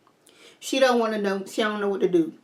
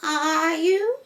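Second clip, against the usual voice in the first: doubting grandma, higher; a skeptical old lady pushing back; evil grandma voice; 9 semitones higher than usual. Acted. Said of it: "my evil city grandma".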